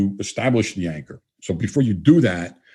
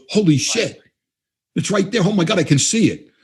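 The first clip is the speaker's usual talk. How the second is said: higher-pitched imitation of sudden astonishment; amazed realization; 9 semitones higher than usual. Client suddenly seeing his own idea, retold amazement. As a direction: act out an astonished discovery, pitch lifted.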